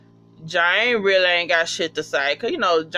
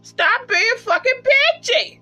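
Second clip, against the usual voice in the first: teasing yell. Retold or imitated. Telling Jane off at the screen, a high teasing yell. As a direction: pitch it up and yell the scolding. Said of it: "And I like".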